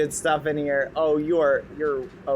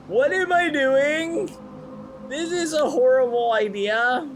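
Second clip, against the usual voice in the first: panicked whine; 9 semitones higher than usual. Acted. Panicked self-scolding.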